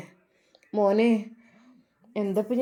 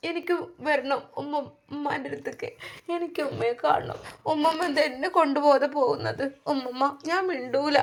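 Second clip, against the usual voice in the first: put-on sobbing little girl; 6 semitones higher than usual. A narrator's reading. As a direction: whimper it like a small girl crying beside the body. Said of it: "വിതുമ്പ കൊണ്ടുള്ള ആ ഒരു കരച്ചിൽ".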